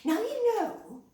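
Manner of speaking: plain reading voice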